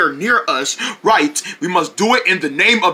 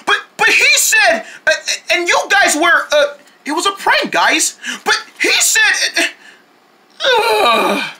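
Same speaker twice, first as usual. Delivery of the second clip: high flustered stammer; 9 semitones higher than usual. Acted. Confused after prank reveal.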